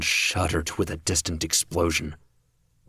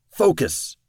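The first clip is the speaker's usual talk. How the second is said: sharp, loud, much higher bark; barked order to a rattled teammate; barked command; 11 semitones higher, 6 dB louder than usual. A narrator's reading. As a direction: snap it out to regain control. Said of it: "Kraus barked the word".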